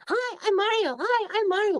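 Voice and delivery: very high squeaky mock voice; mocking imitation. Trolls in his DMs making fun of him, mocking falsetto. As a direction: mimic the online hecklers in a silly high-pitched voice. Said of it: "making fun of me".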